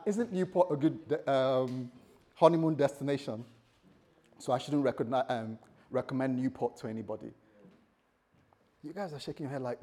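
ordinary delivery

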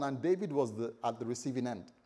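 usual speaking voice

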